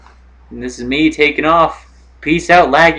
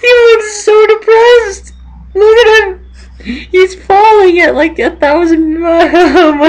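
very high, squealing through laughter; laughing hard. Real, not acted. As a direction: barely get the words out for laughing. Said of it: "I'm laughing so hard".

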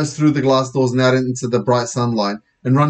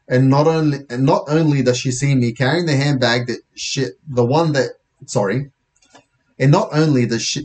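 usual speaking voice

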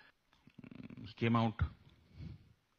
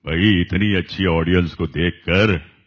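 booming deep villain voice; loud deep Mogambo delivery; Amrish Puri impression; 4 semitones lower, 8 dB louder than usual. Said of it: "Amrish जी".

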